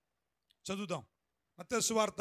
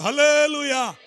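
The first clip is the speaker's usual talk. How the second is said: hallelujah shouted out again, louder; 4 semitones higher, 16 dB louder, slower than usual. Real, not acted. Preacher asking everyone to say it louder. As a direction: call it out at full voice. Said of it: "మరోసారి చెబుదాం గట్టిగా అందరం".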